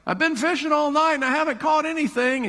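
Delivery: high, complaining fisherman voice; frustrated fisherman whining up high; whiny Peter. Retold or imitated. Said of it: "and he's like".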